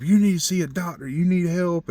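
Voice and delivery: high, urgent plea to see a doctor; a worried lady insisting he get help; panicked urging. Retold or imitated. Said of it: "she starts panicking".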